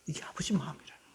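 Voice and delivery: 숨소리로만 속삭이듯; 비밀을 털어놓듯 낮게 속삭여; 속삭임. Real, not acted. (회중에게 속삭이는 목소리)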